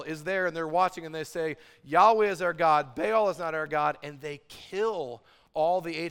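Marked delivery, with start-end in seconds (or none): 1.8-4.0 loud, emphatic declaration; crowd proclamation. Acted. All of Israel watching the showdown, loudly proclaiming. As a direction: proclaim it forcefully for a whole nation.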